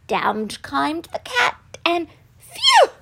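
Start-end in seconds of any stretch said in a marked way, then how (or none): 2.4-2.9 very high, loud phew; relieved phew. A narrator's reading. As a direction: let out an exaggerated whew of relief. Dog relieved after the danger.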